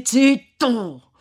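loud, high command to be silent; sharp interruption. A narrator's reading. Friar silencing Renzo with a loud sharp command. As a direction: cut him off hard. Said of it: "interruppe il frate".

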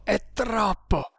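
high, slow, strained groan; gasped plea. Acted. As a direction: drag the words out in pain. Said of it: "rantolò".

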